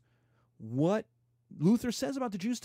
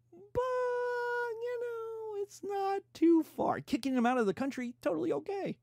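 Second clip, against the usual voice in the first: much higher, mock-breezy sarcasm; sarcastic; 14 semitones higher than usual. Lecturer mocking Luther's rule book, high sarcastic tone. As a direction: toss it off as if it's nothing, dripping irony.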